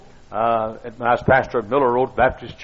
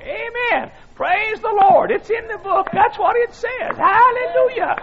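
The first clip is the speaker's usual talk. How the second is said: very high, drawn-out squeaky praise; squeaky old voice. Retold or imitated. Old man praising from his rocker during preaching, squeaky. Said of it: "In a squeaky old voice".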